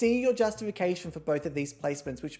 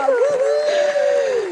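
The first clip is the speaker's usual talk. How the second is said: much higher, louder woo-hoo shout; cheer; 10 dB louder than usual. Acted. Host cheers the drag queen Ebony Lee, loud whoop. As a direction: whoop it up for the winner. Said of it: "let me do a cheer".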